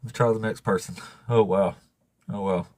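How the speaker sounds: ordinary delivery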